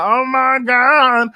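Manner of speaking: slow, high oh my God in fake alarm; mock shock. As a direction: drag out the gasp of panic. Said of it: "He's like".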